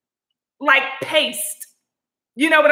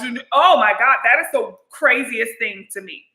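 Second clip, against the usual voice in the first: normal talking voice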